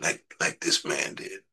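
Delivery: ordinary talk, nothing marked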